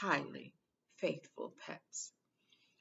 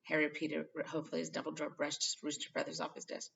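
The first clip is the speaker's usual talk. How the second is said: plain reading voice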